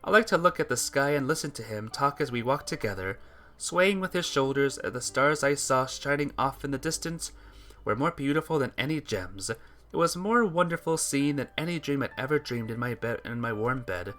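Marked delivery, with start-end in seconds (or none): none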